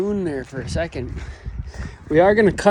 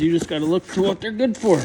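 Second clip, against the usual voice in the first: ordinary delivery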